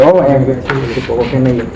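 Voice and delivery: ordinary talk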